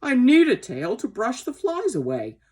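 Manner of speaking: high, loud, aggrieved protest; angry old horse. A narrator's reading. Sir Oliver laments his lost tail, angry protest. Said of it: "all this made him very angry".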